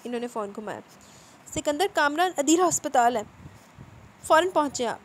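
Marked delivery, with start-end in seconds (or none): 1.5-5.0 loud, higher panicked call; frantic shout. Acted. Mother screaming to her husband on the phone. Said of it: "वो चीखी।".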